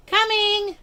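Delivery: high loud answering shout; yelling back. A narrator's reading. Girl answers mom's supper call, high loud shout. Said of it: "I yelled back".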